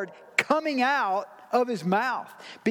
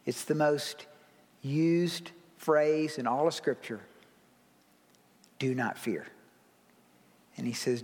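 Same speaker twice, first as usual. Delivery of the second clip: tender hush; 8 semitones lower, 5 dB quieter than usual. Real, not acted. Pastor comforting the congregation before worship, hushed and tender. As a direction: lean in close and comfort them gently.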